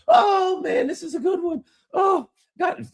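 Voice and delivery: high-pitched, hammed-up cackling; acted laughing. Both men laughing at their joke on the ships, acted hilarity. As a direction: laugh hard, then squeal out the words. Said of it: "oh, they're laughing".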